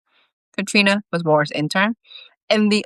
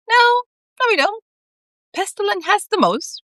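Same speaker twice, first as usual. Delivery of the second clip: cat voice, pitched up, protesting. A narrator's reading. Constantine objects.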